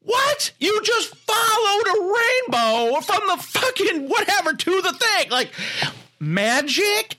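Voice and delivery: can't believe what you're hearing; real exasperation. Real, not acted. Host ranting at the movie in high-pitched disbelief. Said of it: "Can you tell I was annoyed?".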